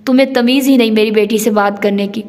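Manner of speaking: usual speaking voice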